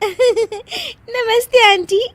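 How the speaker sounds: slow, high greeting through giggles; laughing greeting. Acted. Daughter-in-law greeting mother-in-law's friends, giggling. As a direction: greet politely but giggle.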